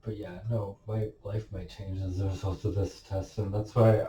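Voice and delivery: quiet, holding back emotion; trying to keep the voice steady; steadying shaky voice. Real, not acted. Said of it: "I'm getting now emotional".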